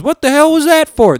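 high, loud indignant southern drawl. Retold or imitated. Husband just smacked by his wife, indignant southern drawl. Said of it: "This is his accent, by the way".

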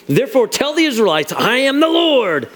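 God announcing He will act; declaring God's word. Acted. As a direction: proclaim it, stretching the final words. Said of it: "Just the way you say that's like".

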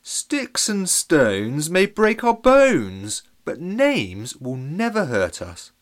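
slow, loud, solemn pronouncement; wise old man. Acted. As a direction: intone it like ancient wisdom. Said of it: "Trevor uttered the ... words".

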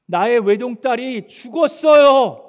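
loud, high, slow furious cry; angry lament. Pretend. Father blaming Jesus for his only daughter's death, angry cry. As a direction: wail it out in anger. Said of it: "엄청 화가 났을 것 같습니다".

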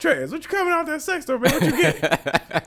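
high, teasing mock voice; laughing through a high, mocking impression of the guys; mocking friends. Friends tease him leaving sex store.

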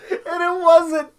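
high, loud laughing through the words; cracking up, almost in tears; laughing helplessly. Real, not acted.

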